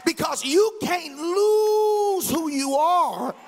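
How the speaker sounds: preaching at full force. Acted. Pastor rousing his congregation with a full-force preaching cry. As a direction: lean into it like a Sunday climax.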